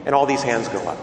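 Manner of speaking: ordinary talk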